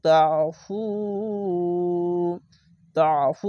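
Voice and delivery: তাফু with a long held final u; three-count stretch. Shown as a demonstration. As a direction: draw out the fu of তাফু. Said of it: "তিন আলিফ টেনে পড়বেন". (তাফু-র ফু তিন আলিফ টেনে)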